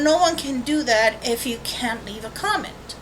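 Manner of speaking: ordinary delivery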